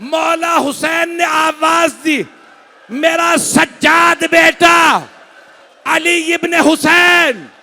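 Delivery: high, loud, drawn-out majlis recitation; preaching at full force. Acted. Preacher to mourners, high chanted preaching. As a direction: lift the mourners with a wailing tune. Majlis peak, shouting lament.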